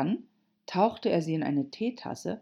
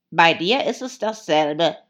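loud, forceful Hatter voice; Hatter, retorting; 10 dB louder than usual. Acted. Hatter putting down the dormouse, loud and curt.

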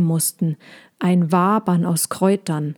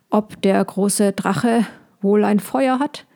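ordinary delivery, nothing marked

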